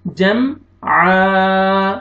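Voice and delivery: जम closed cleanly, then आ held very long; clear unhidden meem. Shown as a demonstration. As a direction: finish the m of जम, then stretch आ from the throat. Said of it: "आपने जल्दी पढ़ना है". (जम साफ़, फिर आ खींचकर)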